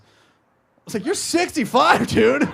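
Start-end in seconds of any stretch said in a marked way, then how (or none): 1.0-2.5 loud, high, drawn-out exclamation; mock disbelief. Son to his sweet-toothed father, mock incredulous yell. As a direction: stretch it out high, appalled.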